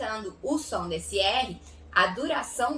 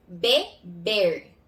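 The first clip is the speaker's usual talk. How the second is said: "Beber" split into be, pause, ber; syllable by syllable; 5 semitones lower than usual. Shown as a demonstration. Teacher separating syllables for pupils, split word. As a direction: say "Beber" in two separate beats. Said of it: "Vamos fazer a separação de sílaba".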